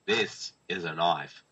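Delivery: slow, low, lightly voiced Australian drawl; a rough Crocodile Dundee in Australian; quoting the knife line in a hint of Australian. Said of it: "I can do Toby".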